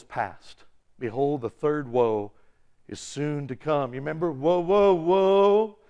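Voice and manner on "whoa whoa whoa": drawn-out rising "woe, woe, woe"; mock whoa-whoa. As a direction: stretch each word playfully. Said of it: "It's not that".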